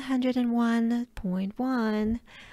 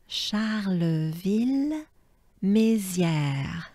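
Charleville-Mézières drawn out very slowly; very slow model; much slower than usual. Shown as a demonstration. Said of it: "Let's try as a whole".

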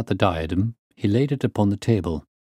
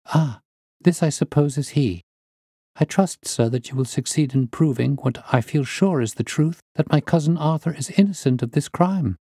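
put-on higher-pitched, hopeful young woman; earnest niece; 6 semitones higher than usual. Niece telling the detective her cousin is innocent, high and earnest. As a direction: appeal to the detective in a raised pitch.

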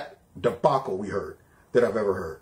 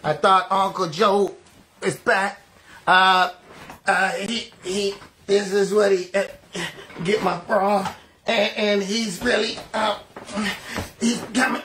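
halting, lightly raised mock-swooning voice; mock Van Jones; 3 semitones higher than usual. Host mocking a pundit undressing as he speaks, halting mock swoon. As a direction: stammer as if overcome while undressing. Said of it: "while he's saying this".

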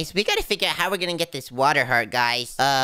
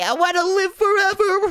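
high, loud dramatic wail; over-the-top high yell; mock-desperate cry; 5 dB louder than usual. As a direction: cry it out high like a melodramatic plea. Playful fear of dying.

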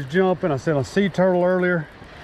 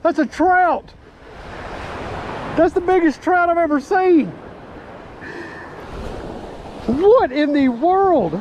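real amazement; 10 semitones higher, slower than usual. Real, not acted. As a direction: yell it like you just saw the biggest trout of your life. Huge trout landed, shouting amazement.

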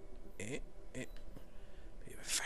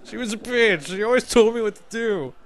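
mock whiny dumped lover; 9 semitones higher than usual. Mock whiny rant after breakup.

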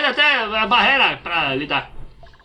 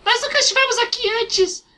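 high, squeaky fairy voice. Acted. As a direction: voice a tiny fairy, high and bright. Voicing fairy character lines.

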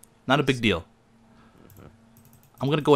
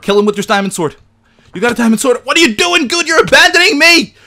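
high, very loud frantic shouting; real panic building to a shriek; panicked yelling; 12 dB louder than usual. Real, not acted. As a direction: scream for a teammate's help, escalating. Abandoned during a monster attack.

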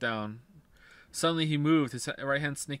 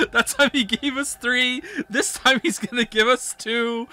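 high, loud, laughing through words; laughing fit; 10 semitones higher, 8 dB louder than usual. Real, not acted. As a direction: talk while cracking up. Laughing fit while talking.

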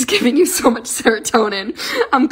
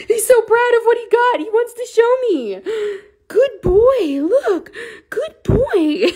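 high sing-song pet voice, much higher; 12 semitones higher than usual. Real, not acted. Owner praising her kitten in high cooing baby talk. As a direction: coo at the kitten like a proud mom.

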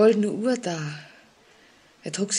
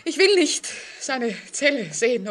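much higher, pleading panic; frightened refusal; 8 semitones higher than usual. Acted. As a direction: push the thought away in fear. Woman refuses to see grave.

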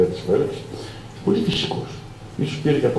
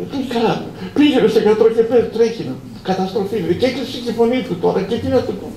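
panicked; 12 semitones higher, faster than usual. Retold or imitated. Boss in a panic.

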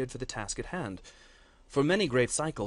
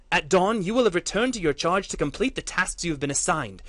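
higher, much louder, stern furious orders; furious shout; 7 semitones higher, 11 dB louder than usual. Acted. Matriarch ordering battle lords back to their posts, furious shout. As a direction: lay down the law, threatening. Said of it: "shouted Sora".

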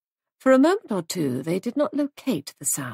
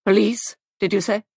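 terse question, first word punched; short, staccato; 5 dB louder than usual. A narrator's reading. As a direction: break it into short bursts. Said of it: "in a short, staccato voice".

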